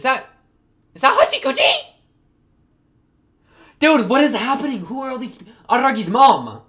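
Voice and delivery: high, loud shocked outburst; astonished. Real, not acted. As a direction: exclaim in disbelief at who just appeared. Startled by characters suddenly appearing on screen.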